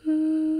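a high loud closed-mouth "Mm" hum. Shown as a demonstration. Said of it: "whether that humming noise is higher or lower".

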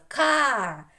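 "Ка" loud, high and held; sounding out syllable. Shown as a demonstration. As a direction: stretch the vowel of "Ка" loudly. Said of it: "Другий склад".

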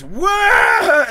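strained high shout that cracks; failed high-pitched attempt. Shown as a demonstration. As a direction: push for a high pitch and fail. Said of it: "I'll try it right now to show you".

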